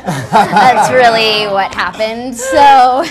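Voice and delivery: can't stop laughing while insisting. Real, not acted. Telling her Paris story, laughing helplessly. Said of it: "that's not funny".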